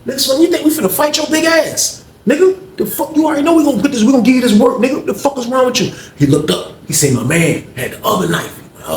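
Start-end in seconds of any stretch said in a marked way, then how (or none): 0.1-5.9 high heated taunt; mock threat. Teller's crew threatening the OG, high heated taunt. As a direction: taunt him, pitched up.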